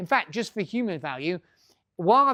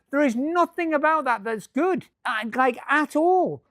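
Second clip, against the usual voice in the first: mock exasperated protest; 7 semitones higher, 4 dB louder, slower than usual. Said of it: "I'm like".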